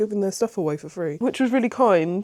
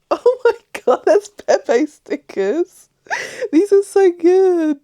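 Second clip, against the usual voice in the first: high, loud, crying with happiness over a gift; tearful delight; 12 semitones higher, 7 dB louder, much slower than usual. Real, not acted. Opening the parcel of free stickers, overwhelmed crying squeal. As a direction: squeal through happy tears, voice cracking.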